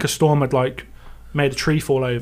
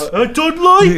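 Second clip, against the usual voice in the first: high, slow hammed-up Brummie; Brummie accent; 12 semitones higher, 4 dB louder than usual. Teasing co-host about his supposed accent, Brummie. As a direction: exaggerate a Dudley Brummie accent, pitched right up. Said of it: "This is what John thinks I am".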